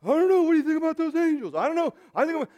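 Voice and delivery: fast, high, flustered worrying; mock fretting. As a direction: play the anxious believer fussing over angels. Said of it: "freaking out and going".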